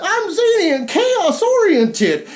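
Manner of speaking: high, slow, laughing zany voice; giggly hammed-up chaos gremlin; zany mock voice. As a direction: play up the chaotic goofball. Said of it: "red is the".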